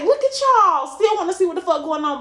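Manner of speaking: mock the haters with a sing-song jab. Taunting social media haters who only watch, mocking high voice. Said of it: "I was like".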